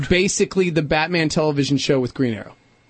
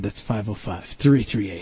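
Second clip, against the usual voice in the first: quiet aside; 8 semitones lower, 5 dB quieter than usual. Real, not acted. Repeating the number quietly.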